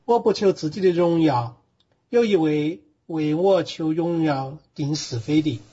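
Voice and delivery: steady verse-by-verse reading with Sichuanese pronunciation and tones; Sichuan dialect. Shown as a demonstration. Bible student reading John for the teacher on request, in Sichuan dialect. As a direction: read scripture aloud in Sichuan dialect. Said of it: "四川话念一念".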